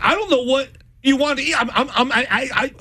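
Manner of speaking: high-pitched, stammering helplessness; mock flustered man. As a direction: act the panicked partner scrambling for an answer. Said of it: "And then just escalates".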